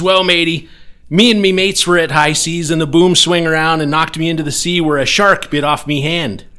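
loud, high pirate bellow; booming pirate voice. Acted. Pirate telling the insurance agent how he lost his leg, booming. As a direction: boom it like an old sea pirate. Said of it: "the accent".

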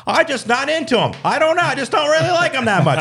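high, loud announcement to the whole table; mock the woman's blunt announcement, pitched well up; loud rude woman. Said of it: "she just announced it to her table".

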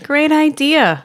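high, slow, loud great idea; mock boardroom praise. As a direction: play the pleased boss. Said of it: "And they're like".

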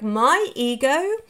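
normal talking voice

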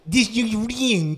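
exaggerated Russian accent with added y sounds; high, hammed-up Russian accent; mock Russian accent. Shown as a demonstration. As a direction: demonstrate a silly Russian accent. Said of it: "the best way to do a Russian accent".

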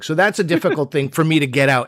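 ordinary delivery

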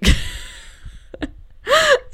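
high, bursting laugh at herself; a loud, squealing giggle at the flat ending; real laugh. Real, not acted. Host laughs at her own story's limp ending, high and loud.

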